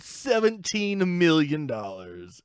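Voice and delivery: slow, drawn-out appraiser building to the reveal; antiques appraiser. Acted. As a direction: announce the valuation slowly, pitch lifted on the big words. Plays antiques appraiser announcing value.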